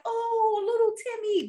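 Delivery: high-pitched, exaggerated doting mom; hammed-up sweet mom lilt. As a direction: coo over a little boy, voice way up.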